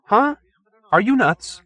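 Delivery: high, loud disbelieving yelp; astonished reporter, pitched well up; incredulous. A narrator's reading. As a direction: react as if he's gone mad. Perkins shocked at refusal.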